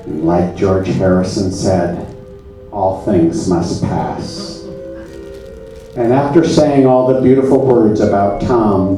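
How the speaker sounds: ordinary talk, nothing marked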